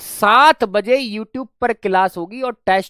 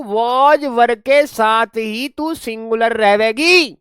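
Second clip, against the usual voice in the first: slow, louder and higher, stretching singular रहेगी; slow emphatic rule; 5 semitones higher, 4 dB louder, slower than usual. Shown as a demonstration. Teacher stressing the key grammar rule to students, slow and loud. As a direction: spell out the rule word by word. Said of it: "ये वाली बात ध्यान से सुनना मेरी".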